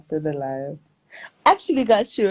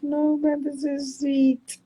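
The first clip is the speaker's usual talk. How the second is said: touched squeal; 10 semitones higher, 3 dB louder, slower than usual. Retold or imitated. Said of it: "But I'm like".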